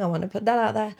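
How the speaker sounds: ordinary delivery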